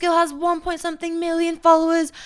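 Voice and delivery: high, excited mock gushing; imitated excitement. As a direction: play the guy suddenly impressed by her numbers. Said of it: "He was like".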